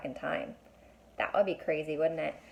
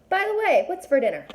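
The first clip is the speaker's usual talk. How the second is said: mock casual; 10 semitones higher, 6 dB louder than usual. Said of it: "sarcastic imagined line to Mom".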